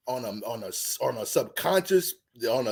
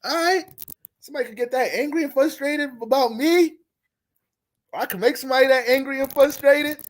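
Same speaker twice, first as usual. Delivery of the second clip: mock delighted woman; 7 semitones higher than usual.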